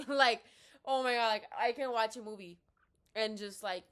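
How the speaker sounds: loud, laughing gush of relief; laughing excitedly. Real, not acted. Laughing loud to her viewers about skipping homework. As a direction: laugh through the words, thrilled.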